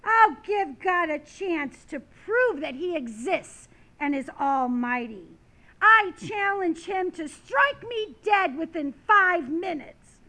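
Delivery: loud, defiant orator's dare; dramatic challenge. Acted. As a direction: throw down a bold public challenge. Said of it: "he dramatically took out his watch and said".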